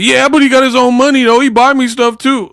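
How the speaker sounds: very high comic whine; mock defensive over-explaining. Defending [name] when asked if he's married, high mock-defensive. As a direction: imitate someone eagerly defending a partner. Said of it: "you just volunteering information".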